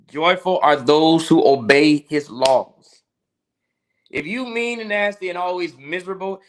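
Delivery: preaching. Acted. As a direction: drive the point home to the congregation. Preaching on integrity to viewers.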